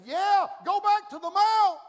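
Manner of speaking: high, loud divine order; shouted command. Acted. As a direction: boom the command at the prophet. God voiced commanding.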